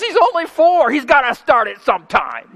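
a hint of the wife defending the boy; preacher quoting his wife on their four-year-old son; wife's voice. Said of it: "My wife says".

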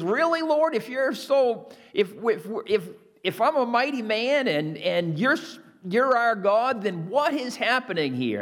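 exasperated Gideon. Acted. Preacher voices Gideon's complaint.

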